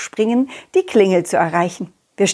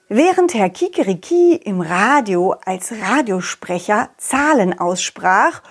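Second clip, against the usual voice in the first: ordinary talk, nothing marked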